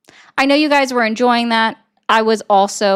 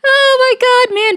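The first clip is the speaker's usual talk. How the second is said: exasperated outburst; 12 semitones higher, 5 dB louder than usual. Real, not acted. Exasperated at chatter.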